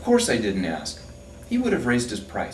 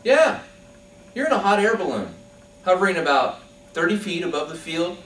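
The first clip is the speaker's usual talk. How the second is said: higher, precise, pedantic reeling-off; the architect's fussy answer; know-it-all; 6 semitones higher than usual. Acted. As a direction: recite the coordinates like a smug expert. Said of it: "he says".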